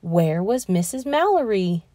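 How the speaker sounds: ordinary delivery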